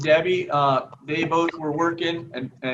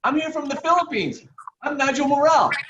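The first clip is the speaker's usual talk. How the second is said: lightly voiced, higher pleading young man; a hint of a long-lost cousin announcing himself to Debbie; mock Nigel introducing himself; 12 semitones higher than usual. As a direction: play the lost cousin, a touch earnest. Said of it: "He goes".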